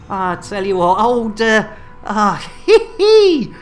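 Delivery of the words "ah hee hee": giggle. Real, not acted. Giggling after his own joke.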